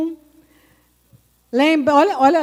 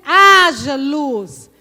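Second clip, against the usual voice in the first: slow, loud divine command; commanding decree; 7 dB louder, much slower than usual. Acted. Preacher voicing God creating light, slow and loud. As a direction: proclaim it like God speaking light into being. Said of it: "Então Deus disse".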